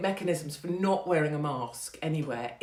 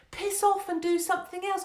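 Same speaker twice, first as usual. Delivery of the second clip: high dismissive piss off; 13 semitones higher than usual. Real, not acted. Rant at critic.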